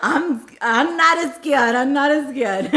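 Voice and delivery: nervous laughing affirmation; nervous self-reassurance. Real, not acted. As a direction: talk yourself past the nerves. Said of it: "I'm gonna keep saying that till I get to you".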